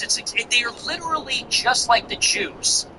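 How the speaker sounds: usual speaking voice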